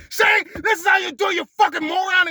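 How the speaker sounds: high shouted insult; mock furious yell. Imitating an aggressive teacher, high loud shout. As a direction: bark it like a bully teacher.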